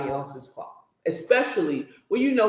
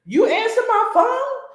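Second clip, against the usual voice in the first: high, loud, laughing retold yell; man catching his phone answered, mock yelled through laughter; laughing mock outrage; 13 semitones higher, 4 dB louder than usual. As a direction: play his anger while cracking up. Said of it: "He's like, he's like".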